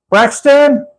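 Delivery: high, playful call through a car trunk; teasing call to someone inside. Shown as a demonstration. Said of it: "like that".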